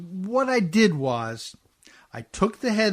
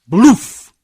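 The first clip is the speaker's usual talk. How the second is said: loud mock blast sound; hammed-up explosion noise; 3 semitones higher, 14 dB louder than usual. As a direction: burst out a big boom. Imitates the torpedo blast.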